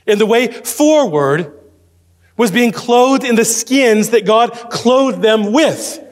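high, forceful pulpit delivery; emphatic preaching. Real, not acted. Preacher on being clothed by God, emphatic preaching.

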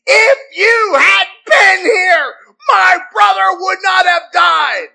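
high, slow, loud reproach; accusing outburst with attitude. Acted. Martha confronting Jesus over her brother's death, accusing shout. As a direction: hurl each word as an accusation. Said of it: "Martha's coming with a beautiful attitude".

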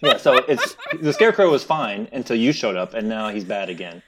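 amused, laughing chatter. Real, not acted. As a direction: laugh while you say it. Group laughing.

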